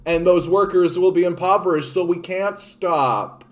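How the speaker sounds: high, loud mocking whine; mock the whining excuse makers; mocking whiny excuse. Mocking politicians' excuse, loud and high. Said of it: "workers, right? That".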